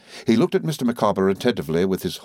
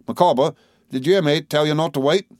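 higher-pitched, irked demand; impatient employer; 8 semitones higher, 3 dB louder than usual. A narrator's reading. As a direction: challenge a disobedient underling. Employer challenges a stubborn clerk.

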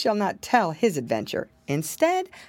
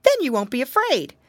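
higher, lightly voiced little pig; wary piggy boy musing to himself; lightly raised boyish piglet voice; piggy boy thinking; 6 semitones higher, 5 dB louder than usual. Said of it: "said Curly Tail".

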